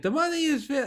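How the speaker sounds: put-on a weak stab at an Israeli accent, high-pitched. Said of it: "I can't do an Israeli accent".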